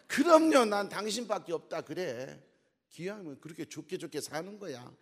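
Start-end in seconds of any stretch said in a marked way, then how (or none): 0.1-1.8 high, loud, gushing yes; eager wife's reply. Retold or imitated. Wife answering her husband, loud eager yes. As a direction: burst out with it, no doubt at all. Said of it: "남편이 "당신 다시 태어나면".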